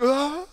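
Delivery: high wailing sob, imitated; imitated high cry. Imitating the crying man, high wail.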